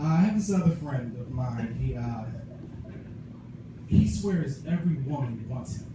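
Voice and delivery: ordinary talk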